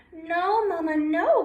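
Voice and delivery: high, loud pleading no; Little Quack begs Mama, high and loud; scared crying. Acted. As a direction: beg Mama, frightened. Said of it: "cried Little Quack".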